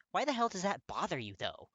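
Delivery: loud, high demand. Acted. Kirishima demanding answers from Katsuki, heated. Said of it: "Kirishima demands".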